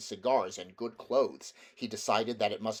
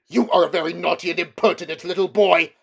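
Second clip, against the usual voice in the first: put-on loud, higher, huffy old uncle; scolding old man; 8 semitones higher, 13 dB louder, faster than usual. Uncle Andrew scolds Diggory.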